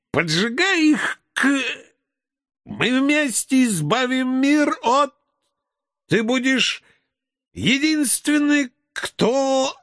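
high, slow, ranting howl; manic drunken shouting. Acted. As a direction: bellow поджигай, stretched and wild. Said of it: "старательно орал". (пьяно и истошно, протяжное поджигай)

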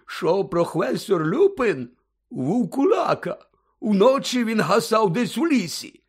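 high, slow, heavy-tongued character voice; boozy storybook figure, drawn out and high; tipsy Hagrid, explaining. A narrator's reading. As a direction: spell out the news like a drunk confiding loudly. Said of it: "його посмішка трохи згасла".

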